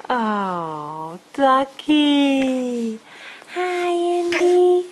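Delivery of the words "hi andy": high, slow duck greeting; toy duck voice. Pretend. Voicing the duck to baby.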